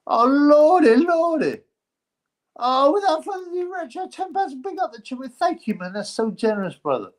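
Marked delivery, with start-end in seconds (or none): 0.1-4.8 high, loud, thrilled "lordy, lordy"; gasp, then squeal it out with joy; delighted squeal. Real, not acted. Thrilled by a donation.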